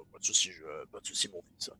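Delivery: low, dark devil voice; demonic voice. Shown as a demonstration. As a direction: answer like a calm demon. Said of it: "la voix diabolique".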